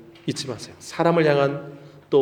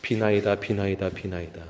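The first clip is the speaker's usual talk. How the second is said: low, fading chant under the breath; mock rote prayer; 5 semitones lower, 8 dB quieter than usual. Archbishop praying as if God were absent, mock rote murmur. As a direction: imitate empty repetitive praying, dropping away softly. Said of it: "이렇게 기도를 했던 거예요".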